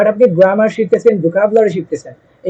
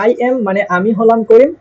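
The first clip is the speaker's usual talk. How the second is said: ordinary delivery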